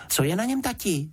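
put-on high, eager child's question; curious little girl. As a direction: ask dad in a high girlish voice. Curious girl asks her father. (zvědavý, vysoký holčičí hlásek)

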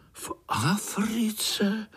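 high, drawn-out question of wonder; amazed. A narrator's reading. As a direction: echo it slowly in astonishment. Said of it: "užasli všichni sborem". (užasle, vysoko a protáhle)

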